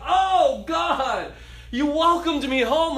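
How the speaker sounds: high, loud, overjoyed thanks; grateful joy. Pretend. Forgiven believer thanking God for welcome, overjoyed.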